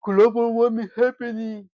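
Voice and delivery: high, sneering mock of protesters. Mocking tire-burning protesters who cry global warming, in a high sneering voice. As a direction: mimic them to ridicule. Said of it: "and saying".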